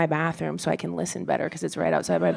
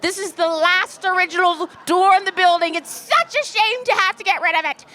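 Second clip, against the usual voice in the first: lamenting landlady; 11 semitones higher than usual. Retold or imitated. Landlady grieving over the original door, higher mock lament. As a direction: mourn the old door theatrically. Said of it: "She's going on and on and on".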